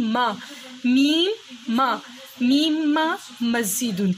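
میم then ما, each stretched slowly; slow sounding out. Shown as a demonstration. Teacher sounds out the letter for the child, slowly. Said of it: "میم کی sound کیا ہو گئی".

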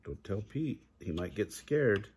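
ordinary delivery, nothing marked

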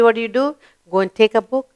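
ordinary talk, nothing marked

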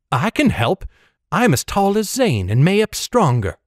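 put-on plucky girl standing her ground; defiant girl. A narrator's reading. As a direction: protest eagerly, pitched well up. Girl protests, shoulders thrown back.

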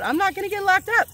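rising fired-up declaration; excited outburst. Real, not acted. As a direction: blurt it out with defiant glee. Defiant outburst in affirmations.

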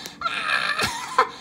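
high shrieking laugh; roaring laugh. Acted. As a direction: roar with helpless laughter. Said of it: "roared with laughter".

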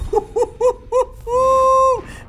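a high, loud burst of laughter; real shrieking laugh; shouting laugh. Real, not acted. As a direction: whoop with laughter at the catch. Big catch, shouting laugh.

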